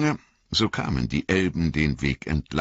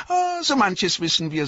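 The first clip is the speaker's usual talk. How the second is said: much higher, louder knowing elf voice; knowing elves; 5 dB louder than usual. A narrator's reading. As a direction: sound mysteriously amused. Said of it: "sagten sie".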